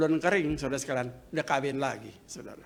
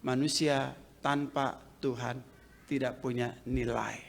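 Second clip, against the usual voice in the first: quiet quote; 8 semitones lower, 8 dB quieter than usual. Shown as a demonstration. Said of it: "seorang teolog mengatakan".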